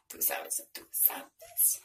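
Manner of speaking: "tú sabes" repeated, dropping to a breathy whisper; fading whisper. Shown as a demonstration. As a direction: let the catchphrase fade into a whisper. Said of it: "repeats catchphrase, fading out".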